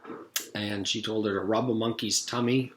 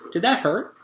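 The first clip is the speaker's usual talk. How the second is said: high, sweet dentist voice; a sugary, sinister dentist; 10 semitones higher, 4 dB louder than usual. A narrator's reading. Dentist voice in story.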